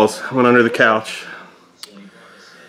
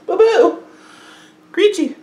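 cooing pet names; slower than usual. Real, not acted. Dad calling his kids by pet names, high and bright. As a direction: sing out the nicknames up high.

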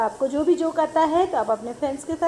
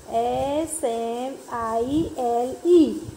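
slow, letter by letter; spelling out; 3 semitones lower, much slower than usual. Shown as a demonstration. Teacher spelling the riddle answer for the class, slowly. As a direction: draw out each letter with space between.

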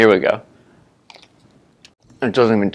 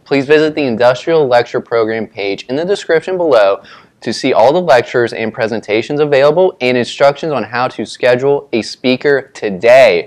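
loud ad read; 2 semitones higher, 4 dB louder than usual. Real, not acted. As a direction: push through the ad excitedly. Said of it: "read whatever we posted".

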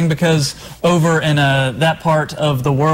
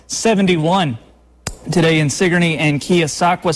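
upbeat, higher-pitched weathercast delivery; TV weather report; 3 semitones higher than usual. Real, not acted. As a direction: present the forecast to viewers, lifting key words. Presents the TV weather forecast.

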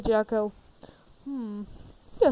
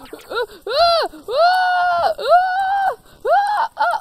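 high, loud panicked yelping; panicked yells; 8 dB louder, much slower than usual. Acted. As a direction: yelp in fright. Startled by a monster.

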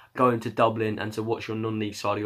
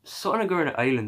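quick, higher mock Irish accent; hammed-up Irish accent; 4 semitones higher, faster than usual. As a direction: say it like a playful Irish fan. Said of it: "I'm gonna stop doing the accent now".

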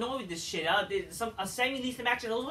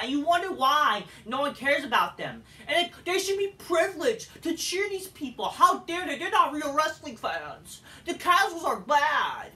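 mocking rant; 6 semitones higher, 6 dB louder than usual. Vlogger mocking smarky fans who look down on casuals, loud and sneering. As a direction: sneer out the snobby fans' view.

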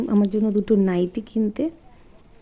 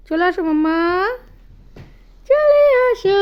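loud call, last words much higher; 9 semitones higher, 8 dB louder, slower than usual. Real, not acted. Mother calling her baby daughter to come to bed, high loud coaxing.

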